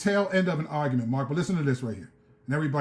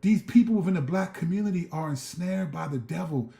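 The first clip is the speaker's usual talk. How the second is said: usual speaking voice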